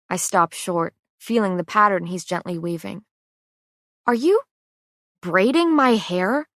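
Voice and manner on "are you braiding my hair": surprised. A narrator's reading. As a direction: ask it in amazed disbelief. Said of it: "Why do you sound so surprised?".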